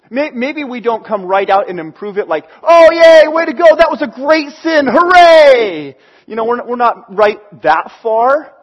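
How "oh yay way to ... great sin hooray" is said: high, loud mock cheer; hammed-up hooray for sin; cartoony cheering. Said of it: "doesn't quite sound that cartoony or funny".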